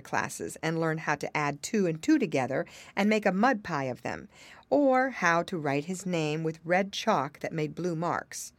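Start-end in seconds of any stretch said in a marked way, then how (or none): none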